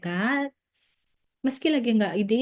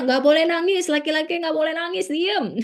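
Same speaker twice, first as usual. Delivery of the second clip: stern father; 7 semitones higher than usual. Retold or imitated. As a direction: snap at a crying boy like a strict dad. Stern father scolding a crying child.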